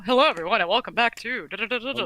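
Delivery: ordinary talk, nothing marked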